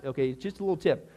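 normal talking voice

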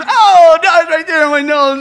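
loud, high mock cry of pain; hammed-up pain. Joke lady crying out to her doctor about her nose, in hammed-up pain. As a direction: overplay the pain as she touches her nose. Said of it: "and she was".

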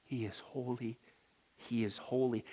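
He is holy said soft and low; reverent hush. Acted. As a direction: breathe He is holy in awe. Reverent hushed repetition.

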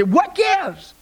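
high-pitched exasperated mock cry; acted crying out. Burdened walker finally cries out, acted exasperation. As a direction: cry out high, fed up with the load. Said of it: "you finally cry out".

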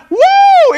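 very high, loud "woo"; excited whoop. Acted. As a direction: let out a piercing joyful whoop. Excitement at hearing good news.

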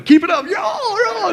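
high, drawn-out crowd holler; imitated rowdy encouragement; encouraging cheer. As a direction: cheer on a street dancer. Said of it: "like they were encouraging him".

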